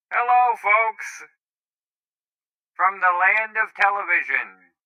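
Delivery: put-on tinny voice from an old television. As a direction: talk as if through an old TV set. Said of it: "it should sound like I'm coming through an old T V".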